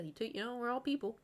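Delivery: normal talking voice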